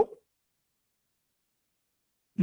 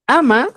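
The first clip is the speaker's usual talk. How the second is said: Ama said high and loud; teacherly emphasis on Ama; stressed ama; 9 semitones higher, 7 dB louder than usual. Shown as a demonstration. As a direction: hit Ama hard to mark prohibition. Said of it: "es prohibición".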